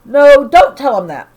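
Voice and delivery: sharp loud no, voiced for the mother; alarmed outburst. A narrator's reading. Mother begs her son not to repeat it, loud alarmed outburst. Mom panics about what son says.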